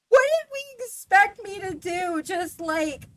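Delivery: high, loud, incredulous rant; exasperated rant. Retold or imitated. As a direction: vent in disbelief. Ranting about caller's expectation.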